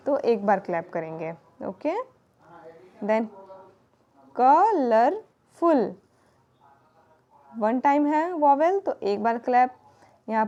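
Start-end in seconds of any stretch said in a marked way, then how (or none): 4.4-5.9 colourful stretched slowly, louder, three beats; syllable demo. Shown as a demonstration. Teacher clapping out the syllables of colourful. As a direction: break colourful into क ल र फुल beats. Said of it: "demonstrating syllables of colourful".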